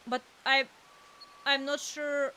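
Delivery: ordinary talk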